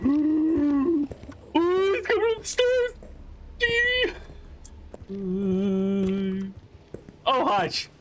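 high, panicked gasps and yelps; real fright as the monster climbs the stairs; terrified. Real, not acted. Streamer hiding from a monster, panicked high yelps. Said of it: "I'm not acting. I'm terrified".

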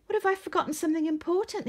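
put-on high, fast child's voice, fretting; a small worried boy voice; anxious little penguin. A narrator's reading. Arthur asks Dad about his packing, high and fretful. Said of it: "he asked".

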